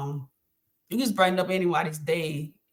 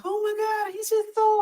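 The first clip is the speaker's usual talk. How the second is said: much higher, swooning lady voice; gushing stranger. Retold or imitated. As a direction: imitate a charmed woman melting over the boy. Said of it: "someone be like".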